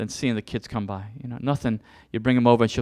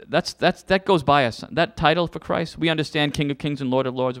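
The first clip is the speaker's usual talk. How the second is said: normal talking voice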